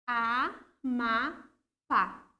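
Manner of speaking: "a, ma, pa" as three separate syllables with long gaps, last one strong; syllable separation. Shown as a demonstration. Teacher showing pupils the syllable split, "a, ma, pa" said one at a time. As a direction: break it up evenly, "a... ma... pa", landing firmly on "pa". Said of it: "separação de sílabas".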